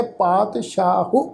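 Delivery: ਪਾਤਸ਼ਾਹੋ said slowly with a sounded final o; wrong pronunciation demo. Shown as a demonstration. Teacher shows students the mistaken reading, drawing out ਪਾਤਸ਼ਾਹੋ. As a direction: demonstrate the wrong ending ਪਾਤਸ਼ਾਹੋ. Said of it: "ਇਹ ਬਿਲਕੁਲ ਗਲਤ ਉਚਾਰਨ ਹੈ".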